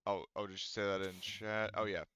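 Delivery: usual speaking voice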